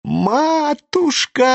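drawn-out high wail of despair; wailing cry. A narrator's reading. Lebedev wailing at the burning money, high drawn-out cry. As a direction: howl the word out, stretched and high. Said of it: "всё ещё вопил Лебедев".

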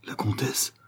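hushed, nearly voiceless murmur. A narrator's reading. Valet recognising the countess, murmured. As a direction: breathe it out under your voice. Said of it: "murmura Zampa".

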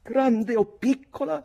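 high, slow, loud challenge; defiant retort. Acted. Redmond retorting to Mick, high and defiant. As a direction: fling it back defiantly.

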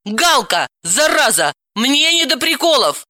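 exasperated scolding; irritated cry. Acted. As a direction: exclaim crossly into the phone. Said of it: "прокричала".

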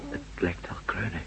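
breathy unvoiced whisper. Man whispering to his friend in the dark garden. Sneaking up on a noise.

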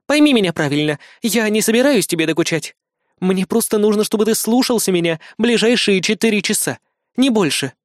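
high, measured bodyguard voice; reasoning Kri. Acted. Кри успокаивает мальчика.

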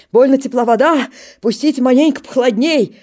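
хрипло; wheezing joke. Acted. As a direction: wheeze it through the cold. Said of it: "хрипит".

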